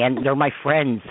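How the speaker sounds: usual speaking voice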